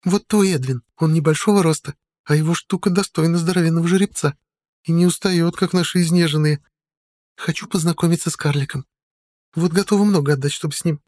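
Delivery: put-on high, loose drunken woman's voice; tipsy woman. A narrator's reading. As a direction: slur a little, cheeky and tipsy.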